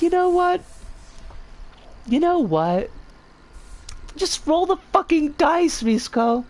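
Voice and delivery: high-pitched, fed-up outburst; exasperated self-scolding. Acted.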